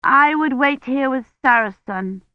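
put-on childish digging in; stubborn. A narrator's reading. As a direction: insist stubbornly, like a small child. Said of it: "sounding almost as stubborn as her sister".

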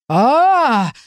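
high, swelling cry; acted scream that rises and falls; startled wail. A narrator's reading. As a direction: wail back in alarm. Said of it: "завопил голос в моей голове".

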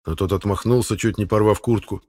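ordinary delivery, nothing marked

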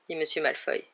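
ordinary delivery

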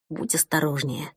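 soft, hushed caution; quiet whisper. A narrator's reading. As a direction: whisper it tenderly. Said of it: "тихо прошептал он".

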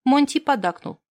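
usual speaking voice

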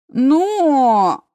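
long drawn-out high and loud. Acted. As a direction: stretch the word out. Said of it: "протянул он". (протяжно)

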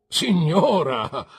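loud, high, drawn-out outcry; long, falling cry; indignant exclamation. A narrator's reading. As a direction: exclaim it with theatrical force. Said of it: "esclamò mister Micawber".

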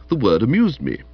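ordinary delivery

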